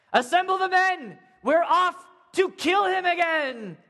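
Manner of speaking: rally the troops for the hunt; king shouting orders to his men; commanding shout. Acted. Saul ordering his army.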